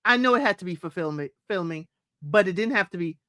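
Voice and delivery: usual speaking voice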